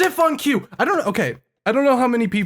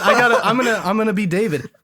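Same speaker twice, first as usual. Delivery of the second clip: usual speaking voice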